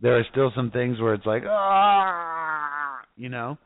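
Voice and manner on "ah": long, higher-pitched "aah"; imitated frustrated groan. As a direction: act out struggling with a temptation. Imitates frustration over lingering sins.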